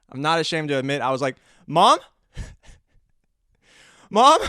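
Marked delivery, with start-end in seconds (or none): 1.7-4.5 high, loud, rising mock-panicked call; calling for Mom. Scared son calls his mother over to the mouse, panicky. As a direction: yelp for help, hammed-up.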